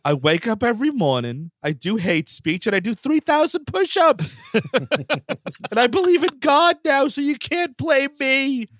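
bro impression climbing in pitch; Mark Wahlberg. Comic as the actor boasting of his new faith, Wahlberg impression. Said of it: "Mark Wahlberg apologized".

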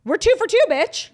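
high, loud brag; excited boast. Real, not acted. Bragging to cohost about keeping their streak, loud and high. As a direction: crow it triumphantly.

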